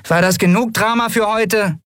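high-pitched, exasperated character voice; high, tart reproach; scolding girl. A narrator's reading. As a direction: cut in with high-voiced impatience. Nelen breaks in, scolding.

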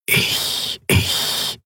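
slow, breathy whisper of a mask spirit; ghostly whisper. A narrator's reading. The bronze mask greets the visitors in a ghostly whisper. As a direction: breathe the word like a voice inside her head.

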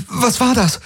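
high, frightened exclamation; startled cry. Acted. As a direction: gasp it out in shock. Startled by an impact. (erschrockener Ausruf)

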